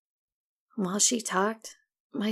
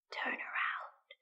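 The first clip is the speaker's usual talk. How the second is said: slow, soft eerie whisper from far off; ghostly whisper; 12 dB quieter, slower than usual. Acted. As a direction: breathe it like an unseen thing. Unseen voice whispers command.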